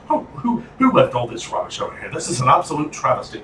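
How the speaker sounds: put-on British accent, indignant rant. Co-host ranting about rubbish as a Brit, mock-indignant British accent. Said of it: "I love doing this accent".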